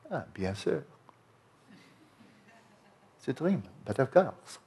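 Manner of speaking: offhand shrug, a casual French bien sûr; nonchalant. Retold or imitated. Dreamer shrugging off realizing it's a dream, nonchalant. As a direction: toss it off like it's nothing special. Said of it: "like, nonchalant".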